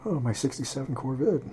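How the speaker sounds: put-on quick mumbled aside in a lightly done old-man voice; Joe Biden impression. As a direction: drop into a soft, lightly voiced Biden musing about his car. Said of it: "I just pulled a Joe Biden".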